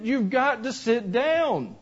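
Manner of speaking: put-on father repeating the order to his girl, slow and stern.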